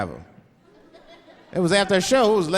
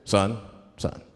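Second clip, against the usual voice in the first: much lower, put-on cop voice; police officer; 10 semitones lower than usual. Police officer to pulled-over driver, low cop voice. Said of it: "the police pull me over".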